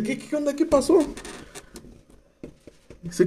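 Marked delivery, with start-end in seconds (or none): 0.0-1.0 high-pitched mock bewilderment; hammed-up high questioning; startled reply. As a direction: act out a puzzled reaction, voice up high. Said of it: "y el otro así de".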